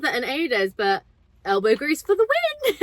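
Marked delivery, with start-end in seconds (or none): 1.4-2.8 excited catchphrase. Acted. Catchphrase repeated, hammed up.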